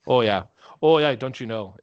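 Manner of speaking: "Oh, yeah" in a sing-song Minnesotan lilt; Minnesotan accent. Shown as a demonstration. Cohost doing the up-north accent for a laugh, in a hammed-up Minnesotan lilt. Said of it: "nice little accent down there".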